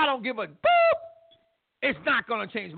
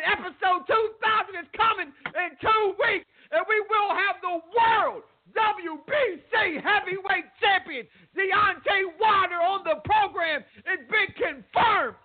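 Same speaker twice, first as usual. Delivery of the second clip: higher, slower, shouted announcer hype; hype announcement; 9 semitones higher, slower than usual. Real, not acted. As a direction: sell the big guest like a ring announcer. Announcing a title fighter's coming guest.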